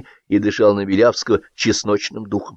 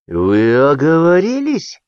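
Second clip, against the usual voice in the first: slow, higher-pitched patronising question; indulgent, slowly rising query; condescending; 6 semitones higher, 3 dB louder, slower than usual. A narrator's reading. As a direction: draw it out as if humouring him. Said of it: "снисходительно сказал Кытин".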